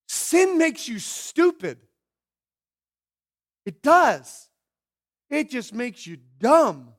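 slow, loud and high insistence; emphatic sermon point. Real, not acted. Preacher telling the congregation sin makes you stupid, slow and loud. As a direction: hammer each word home.

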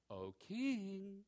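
a hushed, gentle little call; lightly voiced timid heaven calling the king; soft mock heavenly call. Preacher mocks a timid voice from heaven, softly. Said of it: "how do you think that came".

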